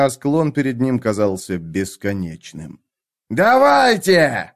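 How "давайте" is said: high drawn-out shout. Acted. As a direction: yell it slowly uphill. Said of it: "кричал сержант Хакон".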